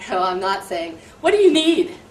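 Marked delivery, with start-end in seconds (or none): 1.2-2.0 much higher, much louder snappy demand; with an attitude. Shown as a demonstration. Teacher showing how not to ask a student, with attitude. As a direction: snap it at the kid with attitude. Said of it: "I'm not asking 'em with an attitude".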